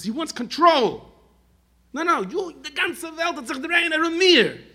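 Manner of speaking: high, loud, forceful outburst; impassioned denouncing shout. Real, not acted. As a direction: cry out the rebuke at full force. Preacher denounces craving for control.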